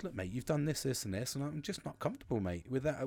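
soft, measured confrontation; calm honest talk. Retold or imitated. Man calmly telling his friend what upset him, soft. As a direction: lay it out softly and evenly.

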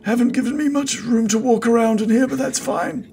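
high, mildly put-out nobleman; NPC voice. Acted. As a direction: grumble it politely as the wizard.

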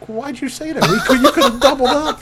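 high, laughing playful protest; laughing mock exasperation. Parents laughing at their daughter for confessing, high mock protest. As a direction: tease her through laughter. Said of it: "we're like".

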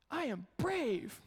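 high and very slow, proudly; little girl voice. Retold or imitated. Dad imitating daughter declaring bravery, high and slow. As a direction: spell it out like a brave toddler. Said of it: "she goes".

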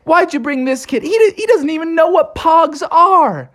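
put-on high annoyed kid voices; complaining kids. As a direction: whine at Bucky about the newcomer. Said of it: "everybody was like".

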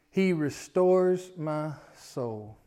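very slow, pausing scripture reading. Shown as a demonstration. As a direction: read each phrase slowly with pauses. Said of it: "I wanna read 'em kinda slow".

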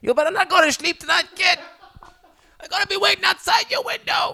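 high, loud cartoonish menace; hammed-up heckler threatening a child; mock threat. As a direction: shout a silly threat through laughter. Plays heckling parents threatening the kid.

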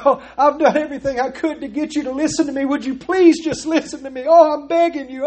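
high, fast whiny pleading, hammed up; mock begging weak God. Preacher mocks the idea of a weak begging God, high whiny plea. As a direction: play a pitiful God begging people to listen. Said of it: "God is not sitting up in heaven saying".

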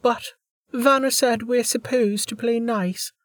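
put-on a confident little girl; young girl voice. A narrator's reading. Maharia, young voice.

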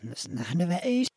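played in reverse; backwards speech. Shown as a demonstration. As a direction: sounds like scrambled reversed words. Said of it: "Get your listening ears on".

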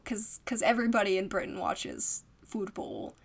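whispering; whispered aside. Real, not acted. A whispered aside about Britain and football. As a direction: drop to a whisper.

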